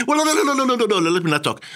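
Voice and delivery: high, fast string of no's; flustered refusal. Acted. Interviewee scared off by the BBC, high flustered refusal. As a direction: back out in a rapid high-pitched babble. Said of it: "So, so you become very".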